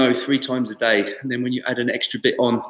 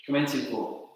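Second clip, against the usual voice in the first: soft, slow calls of each move; calmly name the postures; serene tai chi cues; 11 dB quieter, slower than usual. Acted. Calls tai chi moves softly.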